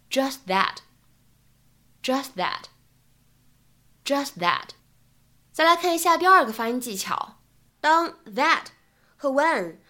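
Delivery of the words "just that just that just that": "just that" with the t of "just" unreleased; incomplete plosion. Shown as a demonstration. Teacher demonstrates incomplete plosion on "just that". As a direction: hold the t in "just" and glide into "that". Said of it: "我们呢可以读成".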